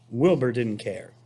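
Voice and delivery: plain reading voice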